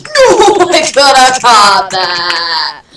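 long, loud howl of protest. Real, not acted. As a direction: cry out in drawn-out dismay. Misses a catch in game.

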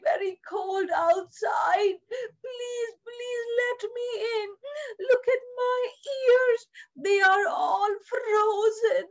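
high, freezing little rabbit; pleading rabbit. Acted. Frozen rabbit begs to enter the mitten, pleading high.

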